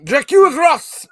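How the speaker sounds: high, loud, drawn-out mock French accusation; screaming French accent. Accusing a co-host of the pretend murder, screamed French accent. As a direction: shriek it as a hammy French accuser. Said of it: "screaming at each other in French accents".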